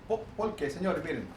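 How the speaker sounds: usual speaking voice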